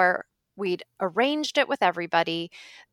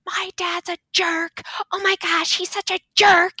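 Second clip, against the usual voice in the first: high-pitched imitated wailing sisters; upset daughters; 11 semitones higher than usual. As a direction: squeal it indignantly, much higher. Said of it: "they're, like, all comforting me".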